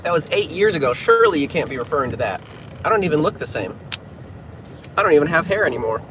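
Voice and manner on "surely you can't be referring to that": usual speaking voice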